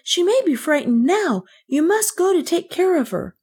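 put-on high-pitched, earnest child; pleading. A narrator's reading. Kay begs the maid to help Rosemarie, pleading. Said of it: "Kay pleaded".

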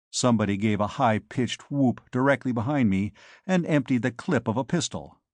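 plain reading voice

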